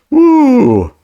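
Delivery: loud high-pitched "woo"; sudden high, loud hoot. Real, not acted. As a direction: let out a long, high whoop. Exhales over two bad games.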